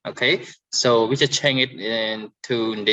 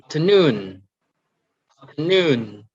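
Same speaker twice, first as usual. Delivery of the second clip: "afternoon" with NOON strong, falling; stress on last syllable; 2 semitones higher, 3 dB louder than usual. Shown as a demonstration. Teacher reading out the last column, final-syllable stress on "afternoon". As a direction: punch NOON in each "afternoon". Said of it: "stress at the end".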